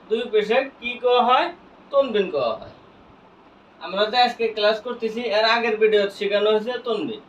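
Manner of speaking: ordinary talk, nothing marked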